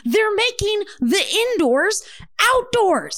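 high-pitched, incredulous imitation of an exasperated doctor; apoplectic expert. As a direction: rant like you're about to have a stroke on air. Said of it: "having a stroke on air".

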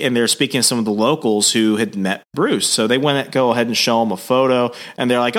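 ordinary talk, nothing marked

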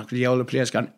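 ordinary talk

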